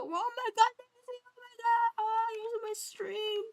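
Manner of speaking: high squealing shriek of delight; shrieking jackpot winner. Real, not acted. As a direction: scream with thrilled disbelief. Streamer shrieks at big surprise.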